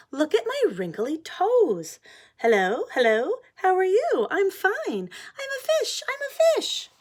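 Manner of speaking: very high, loud playful pigeon voice; silly happy pigeon. Acted. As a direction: goof around like a giddy kid. Pigeon playing happily in bath.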